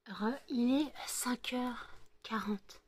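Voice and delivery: slow hushed whisper at dawn; breathy unvoiced morning whisper. Real, not acted. Vlogger whispering to viewers early morning, slow hushed whisper.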